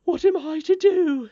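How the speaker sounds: high, loud wail of despair; distraught nurse. A narrator's reading. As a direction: cry out helplessly as a frantic nurse. Nurse in despair over tired child.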